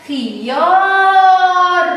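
خيار with a loud, high, long-held aa; almost chant خيار, sustaining the aa; long vowel. Shown as a demonstration. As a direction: stretch the aa in خيار loudly. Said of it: "ولكن تعتبر مد بالإيه؟".

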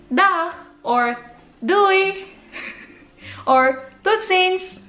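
high cheery "Dag", "Doei", "Tutsins"; cheery goodbyes. Shown as a demonstration. Teaching viewers Dutch goodbyes, sung out high. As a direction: sing out the goodbyes. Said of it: "you can also say".

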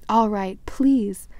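loud grudging snap. Acted. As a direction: spit out the please. Filch forced to say please.

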